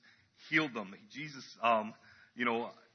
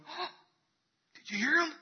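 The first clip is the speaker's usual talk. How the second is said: high-pitched, wide-eyed wondering; eager anticipation; 12 semitones higher than usual. Acted. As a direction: play an expectant onlooker, voice pitched right up. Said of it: "The group that was expectant".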